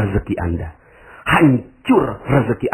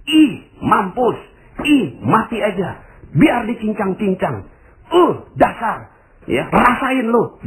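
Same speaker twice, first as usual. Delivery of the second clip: high, spiteful jeering; gloating curse; 9 semitones higher than usual. Retold or imitated. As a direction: sneer at the caught crook. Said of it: "Anda katakan begini". (mencemooh dengan suara tinggi penuh dendam)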